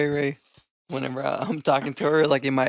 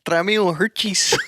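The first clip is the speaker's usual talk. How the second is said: higher, louder mock Mexican accent; 4 semitones higher, 5 dB louder than usual. Ordering candy from a buddy, hammed-up Mexican accent. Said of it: "We got different accents now".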